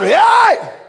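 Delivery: high desperate shout for help. Retold or imitated. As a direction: yell for help from the water. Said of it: "You shout".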